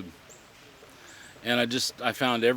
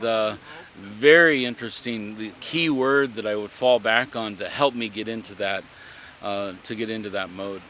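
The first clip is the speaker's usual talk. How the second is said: ordinary talk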